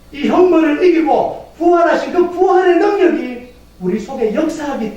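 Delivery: high, driving full-force preaching; impassioned proclamation. Real, not acted. Preacher proclaims Christ's victory to congregation, impassioned preaching. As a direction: proclaim it fervently, pitched well up.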